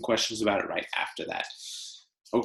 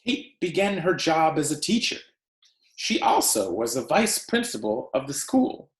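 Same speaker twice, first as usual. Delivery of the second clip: story read aloud; 3 semitones higher than usual. Acted. Teacher reading The Tree Lady to her class, expressive read-aloud. Reads picture book to class.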